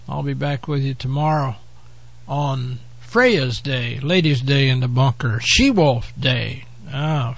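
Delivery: ordinary delivery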